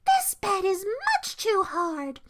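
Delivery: put-on high, slow childish complaint; cried complaint. A narrator's reading. Goldilocks on Papa Bear's hard bed, crying out. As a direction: complain slowly in a high little voice. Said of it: "she cried".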